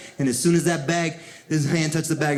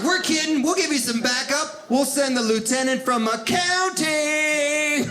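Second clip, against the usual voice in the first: mocking officer voice; 8 semitones higher than usual.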